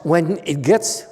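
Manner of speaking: ordinary talk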